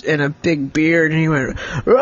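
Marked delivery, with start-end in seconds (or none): 1.9-2.0 long, drawn-out mock pirate growl; pirate roar. Pirate figure's roar acted out.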